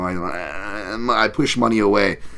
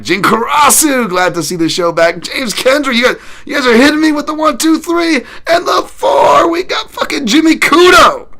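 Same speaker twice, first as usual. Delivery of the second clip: high, loud announcer-style holler; hyped shout-outs; 7 dB louder than usual. Real, not acted. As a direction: build to a screamed, stretched-out roll call. Hyped shout-outs to arriving viewers.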